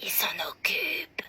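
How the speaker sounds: hushed, secretive whisper. Acted. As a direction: breathe it like a secret. Secret aside after the call.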